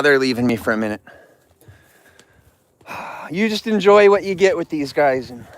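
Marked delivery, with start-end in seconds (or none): none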